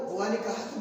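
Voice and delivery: normal talking voice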